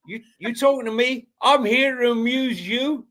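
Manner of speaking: a playful hint of a footballer squaring up; imitating Mickey van der Ven. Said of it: "I thought he was doing his".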